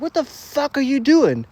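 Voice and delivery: high, disbelieving call after him; incredulous call. Retold or imitated. Narrator calling after his runaway friend, high disbelief.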